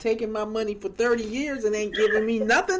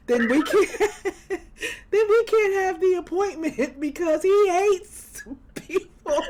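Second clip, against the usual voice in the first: laughing; 7 semitones higher, 3 dB louder than usual. Real, not acted. Laughing while retelling husband story.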